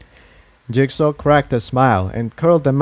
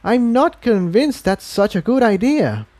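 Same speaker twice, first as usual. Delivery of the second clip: higher mare voice; 10 semitones higher than usual. A narrator's reading. Incendia voiced by narrator.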